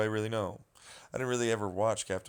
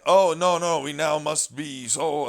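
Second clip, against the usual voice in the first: loud, high, slowed mock gasp; mocking quote; 4 semitones higher, 9 dB louder, slower than usual. Mocking online critics with hammed-up outrage. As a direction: ham up the outrage.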